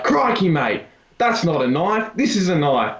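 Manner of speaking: teasing mock Australian catchphrase voice. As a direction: imitate a kid's cartoonish Australian. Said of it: "this one kid would look at me and just be like".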